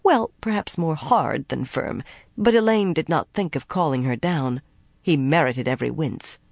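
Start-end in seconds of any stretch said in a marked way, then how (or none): none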